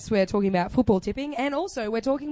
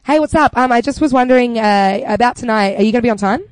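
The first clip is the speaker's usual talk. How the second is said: upbeat caller; 9 dB louder than usual. Pretend. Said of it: "let's pretend".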